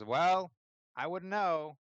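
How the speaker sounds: high, loud innocent protest; feigned innocence. Acted. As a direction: play dumb to the enforcer. Pixo plays innocent to threat.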